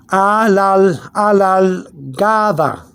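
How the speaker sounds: "alargada" very slowly, soft D like TH; slow Spanish demo. Shown as a demonstration. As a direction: draw out "alargada" syllable by syllable. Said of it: "the T H sound is softer".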